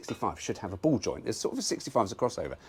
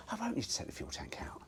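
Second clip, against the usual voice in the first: whispered and hushed, hoping it's true; soft unvoiced whisper to himself; hopeful whisper; 13 semitones higher, 9 dB quieter than usual. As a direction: breathe it out quietly like wishful thinking. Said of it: "I'm having a look going".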